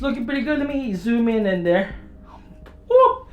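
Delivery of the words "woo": a high, loud whoop of delight; excited woo. Real, not acted. Admiring his glowing gaming PC, high delighted whoop. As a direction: let out a thrilled woo.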